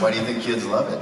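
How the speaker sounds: loud, high laughing question. Real, not acted. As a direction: laugh while asking it.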